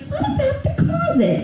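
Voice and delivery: very high, squeaky aunt voice; imitated aunt. Aunt suggesting where to search, squeaky high imitation. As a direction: pitch it way up as a caricatured aunt. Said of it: "my Aunt Pam was like".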